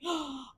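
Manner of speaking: sharp audible gasp; excited gasp. Real, not acted. Streamer realising a plot twist, excited gasp. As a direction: draw a sudden breath of realisation.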